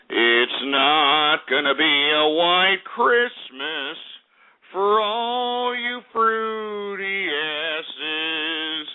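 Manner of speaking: very slow, drawn-out repetition; slow repeat. Real, not acted. Host repeating the forecast slowly to taunt viewers. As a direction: drag each word out to rub it in. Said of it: "and I repeat".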